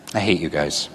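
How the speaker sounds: playful fake resentment; mock hate. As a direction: pretend to hate the audience, teasing. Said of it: "no, I don't".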